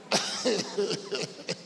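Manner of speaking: short chuckle; laugh. Real, not acted. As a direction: chuckle at yourself. Laughs at himself mid-encouragement.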